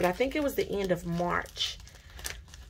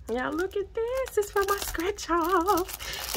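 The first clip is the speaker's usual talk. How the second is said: thrilled; 10 semitones higher than usual. Real, not acted. Vlogger showing her order, marvelling. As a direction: hold it up and marvel.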